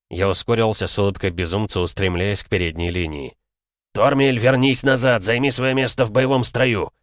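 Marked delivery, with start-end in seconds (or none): 3.9-6.9 commanding order. Acted. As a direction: order him back in line. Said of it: "дал указание капитан".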